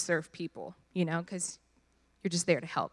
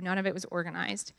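normal talking voice

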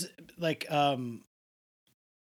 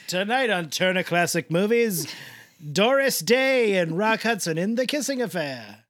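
loud, higher, grand TV announcer parody; mock movie announcer; 4 semitones higher, 5 dB louder than usual. Co-host jokingly promotes The Kissing Affair as a classic film, mock announcer. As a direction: announce tonight's feature with hammed-up flourish.

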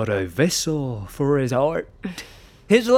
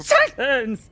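shout; 9 semitones higher than usual. Acted. Shouting her down.

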